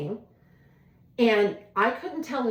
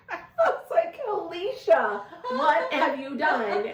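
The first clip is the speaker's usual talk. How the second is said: laughing, much higher and slow; mock self-reproach, laughing high; 7 semitones higher, slower than usual. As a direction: giggle through the self-scolding. Laughing at herself.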